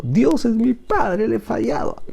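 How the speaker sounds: high, slow, a touch of retold weeping; retold tearful confession. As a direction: voice him lightly as if close to tears, slowly. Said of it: "Y llorando me dijo".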